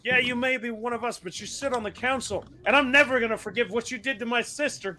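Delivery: high, fast accusing heckler; angry mob member. Acted. Angry rioter answering the councillor, high fast accusing voice. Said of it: "At which point one of them responds".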